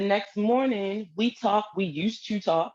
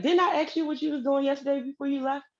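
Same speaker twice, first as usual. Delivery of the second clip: higher, pointed disbelief; incredulous demand; 7 semitones higher than usual. Retold or imitated. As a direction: call him out in disbelief. Confronting boyfriend on phone.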